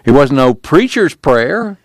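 slow, high-pitched denial; drawn-out, pleading disclaimer; troubled protest. A narrator's reading. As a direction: push back uneasily, voice climbing. Preacher protests further.

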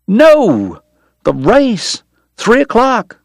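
high, excited outburst; remembering with a jolt; sudden alarm. A narrator's reading. Doc suddenly recalling the race, excited outburst.

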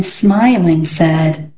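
ordinary delivery

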